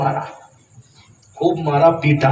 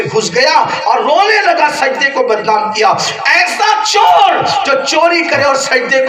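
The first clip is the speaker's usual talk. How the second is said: public crier's shout; 5 semitones higher than usual. Acted. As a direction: shout it through the village. Crier announces thief's punishment. (گاؤں میں اونچی آواز میں منادی)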